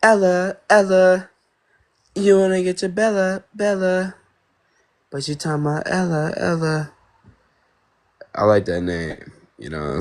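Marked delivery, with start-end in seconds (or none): 0.0-6.9 high put-on girl voice; high-pitched mock girl. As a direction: chant it in a girlish falsetto. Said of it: "Was my girl voice good?".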